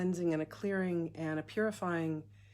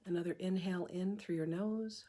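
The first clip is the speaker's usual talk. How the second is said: soft, unhurried meditation voice with long pauses; hushed, calm breathwork leader; guided meditation; 7 dB quieter than usual. Acted. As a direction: guide them gently through each breath, leaving room to breathe.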